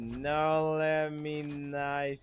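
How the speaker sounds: drawn-out slow-mo voice; slow-motion voice. Shown as a demonstration. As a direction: stretch every word like a replay. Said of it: "you can't do a good slow-mo voice".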